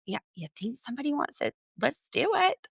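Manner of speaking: fast, rising eager "let's do it" in a put-on voice; eager Mary impression. Host voicing Mary happily agreeing to strip, quick and eager. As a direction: toss it off like a game older woman, quick and keen. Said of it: "She's like".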